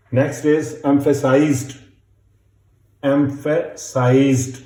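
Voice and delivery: emphasized drawn out at a very slow dictation pace. Shown as a demonstration. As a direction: dictate emphasized slowly, syllable by syllable. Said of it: "dictation teacher".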